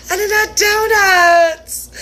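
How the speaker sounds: hammed-up sobbing wail, slow; mock crying. Wailing to herself about missing donuts, in mock sobs. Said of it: "And then I was like".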